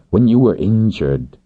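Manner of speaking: "injured" stressed as a pointed correction. A narrator's reading. Man correcting her about her injury, stressing "injured". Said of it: "and he stressed that word".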